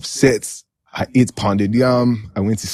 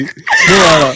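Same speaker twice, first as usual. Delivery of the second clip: louder, higher laughing cry; laughing shout; 6 dB louder, slower than usual. Real, not acted. Telling friends he paid fifty K, laughing shout. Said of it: "I was so upset".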